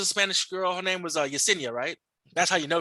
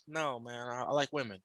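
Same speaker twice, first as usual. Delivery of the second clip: quiet denial; 5 semitones lower, 11 dB quieter than usual. Retold or imitated. His own quoted answer.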